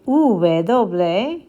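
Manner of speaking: "V doble" said slowly and clearly, oo-beh doh-bleh; slow model pronunciation. Shown as a demonstration. Teacher showing the reversed letter name, slow and clear. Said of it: "You can also do this".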